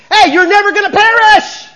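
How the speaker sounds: huge high-pitched shout; double emphatic. Shown as a demonstration. Preacher shows congregation the Greek's force, emphatic shout. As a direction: yell it out with full force. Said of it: "is double emphatic".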